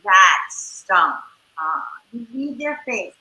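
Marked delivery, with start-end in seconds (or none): 0.0-1.8 low sympathetic. Shown as a demonstration. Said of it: "So what you do is you go".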